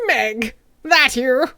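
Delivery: lightly attempted old character voice; slow, rough first stab at a character; terrible voice attempt. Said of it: "No, that's terrible".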